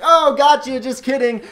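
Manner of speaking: high, loud gleeful prankster voice; Charlie Day. Retold or imitated. River guide pranking the arriving family, high excited voice. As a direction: blurt it high, like a joker springing a gag. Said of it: "high Charlie Day voice".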